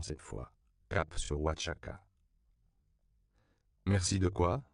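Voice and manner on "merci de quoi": ordinary delivery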